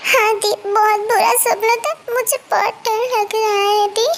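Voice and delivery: tearful, scared. Acted. Girl tells her sister of a bad dream, crying and scared. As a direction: whimper like a frightened child. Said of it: "तुम क्यों रो रही हो?".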